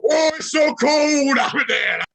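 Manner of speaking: absurd Obama voice. Acted. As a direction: wail it in a hammed-up put-on voice. Continuing absurd Obama act.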